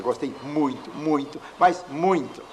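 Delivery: each "muito" punched harder, climbing to the last; emphatic repetition. Shown as a demonstration. Teacher showing repetition as intensifier, mounting stress on "muito". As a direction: build "muito" step by step, highest and loudest on "mas muito". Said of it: "Eu repetindo muitas vezes o muito".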